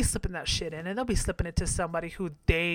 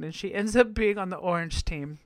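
ordinary talk

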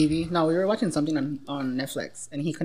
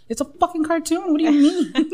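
incredulous; 10 semitones higher, 5 dB louder than usual. Retold or imitated. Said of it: "And I'm like".

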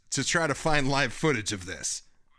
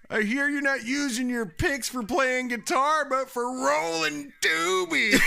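Matt Foley impression; 12 semitones higher than usual. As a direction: play the over-the-top motivational speaker lecturing the kid. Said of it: "That's a pretty good impression".

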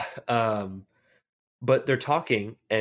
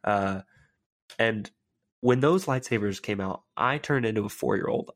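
usual speaking voice

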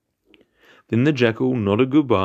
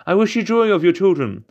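high, loud jeering cry; mocking baboon call; 9 semitones higher, 4 dB louder than usual. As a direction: taunt from up a tree. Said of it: "crying as he perched himself".

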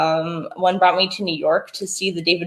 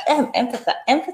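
usual speaking voice

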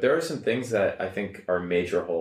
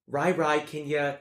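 higher-pitched Midwestern mom; mom's Midwest accent; 8 semitones higher than usual. Retold or imitated. Mom asking a chore of her child, Midwest accent. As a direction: imitate mom calling out in Midwest vowels. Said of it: "My mom's like".

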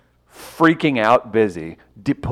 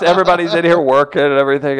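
ordinary delivery

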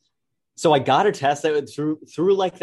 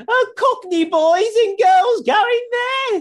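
much higher, louder, slow hammed-up Cockney; mock Cockney; 6 dB louder, much slower than usual. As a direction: shout it out as a cartoon Cockney. Mocking Oxford students' voices.

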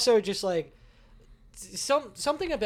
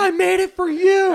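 high, loud whiny protest; friend's whiny voice; 11 dB louder than usual. Retold or imitated. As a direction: whine it back at him. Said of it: "he was like".

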